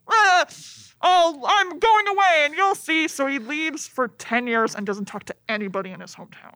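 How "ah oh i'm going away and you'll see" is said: high, loud, slow whiny pout; mock sulky hero. Podcaster mocking the hero storming off, in a loud whiny voice. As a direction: whine it like a wounded teen. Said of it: "so he's like".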